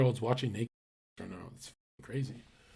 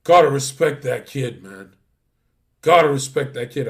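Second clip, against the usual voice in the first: grudging respect; 8 dB louder than usual. Retold or imitated. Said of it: "Gary's like this".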